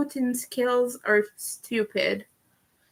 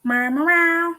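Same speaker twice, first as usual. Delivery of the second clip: higher, louder playful meowing; cat meows; 6 semitones higher, 4 dB louder than usual. Pretend. Streamer meowing playfully at her viewers. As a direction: meow like a silly kitten. Random cat noises for chat.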